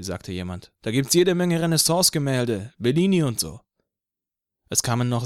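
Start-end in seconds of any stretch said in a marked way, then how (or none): 0.8-3.6 higher, louder eager fan; enthusiastic fan. A narrator's reading. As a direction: sell the idea eagerly. Crowd member agrees.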